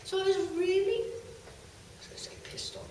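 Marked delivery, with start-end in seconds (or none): 1.0-2.9 half-swallowed aside; hushed self-correction; whispered aside. Real, not acted.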